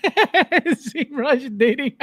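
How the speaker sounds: very slow words stretched through laughter; real laughter over a chat typo; laughing. Real, not acted. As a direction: read it while cracking up.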